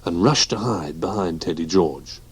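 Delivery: plain reading voice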